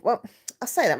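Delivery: ordinary delivery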